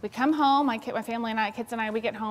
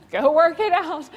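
high, loud curt brush-off; annoyed parent dismissing a tattling child; dismissive parent; 7 semitones higher, 9 dB louder than usual. Retold or imitated. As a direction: wave the kid away.

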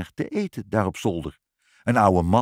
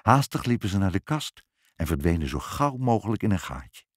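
ordinary delivery, nothing marked

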